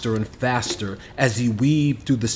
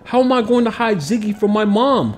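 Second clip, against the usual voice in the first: high, anxious panic; fretting, voice climbing; worried; 10 semitones higher than usual. A narrator's reading. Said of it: "Kamal worried".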